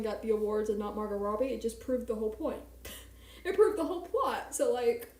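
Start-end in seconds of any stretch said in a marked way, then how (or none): 2.8-4.6 laughing. Real, not acted. Laughing at the point.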